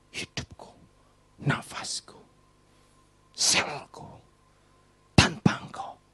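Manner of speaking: soft unvoiced prayer; prayerful whisper. Shown as a demonstration.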